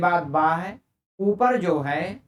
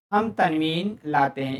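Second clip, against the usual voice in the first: ordinary delivery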